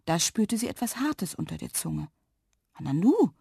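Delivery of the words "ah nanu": wondering, with a rising swoop; child's puzzled little exclamation; puzzled surprise. A narrator's reading. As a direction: sound taken aback, pitch sweeping up. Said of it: "sagte Lilli verwundert".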